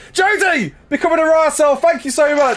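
very high, sustained hype call; excited shout-out. Real, not acted. Streamer thanks a viewer for becoming a member, high excited shout. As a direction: cry out a delighted thank-you.

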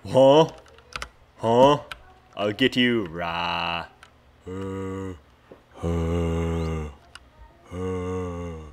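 loud growling zombie groans; zombie noise. Acted. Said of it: "that's why I'm making the zombie noise".

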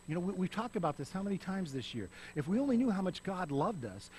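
ordinary talk, nothing marked

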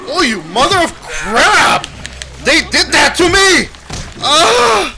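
high, loud, slow outraged shout; angry yell. Real, not acted. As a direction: scream at the game. Attacked in game, yelling.

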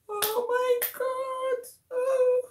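high, drawn-out wail; distraught. Real, not acted. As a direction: cry out in grief. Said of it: "I'm just not happy".

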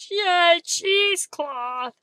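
high, loud, drawn-out cheer; playful cheering. Real, not acted. Crafter greeting a piece of cheesecloth, excited cheer. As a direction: celebrate it with a long, high yay.

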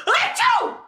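very high, loud acted sneeze; big fake sneeze. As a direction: let out an explosive stage sneeze. Elephant character sneezes in story.

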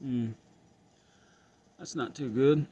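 usual speaking voice

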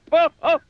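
high quick giggle; a little squeak of laughter; the zookeeper's giggle bursting out; short giggle. Acted. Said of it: "giggled the zookeeper".

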